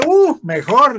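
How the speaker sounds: high, loud, gleeful "mejor"; mock delight. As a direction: burst out with fake joy. Reacción fingida de alegría. (alegría burlona, aguda y fuerte)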